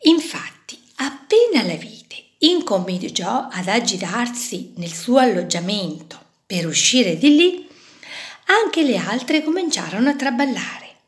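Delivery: ordinary delivery, nothing marked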